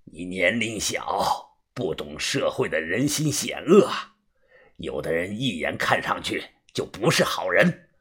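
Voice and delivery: low, hushed rasping warning; a wary old drunk, half whispered; hoarse drunk man. A narrator's reading. Drunk man warns the boy.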